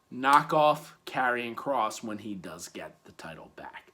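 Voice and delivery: whisper. Real, not acted. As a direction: let it slip as a secret. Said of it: "whispered aside".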